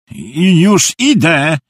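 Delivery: high, drawn-out call; shouted reply. Acted. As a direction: shout back, stretching the words. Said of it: "krzyknął". (głośne, przeciągłe zawołanie)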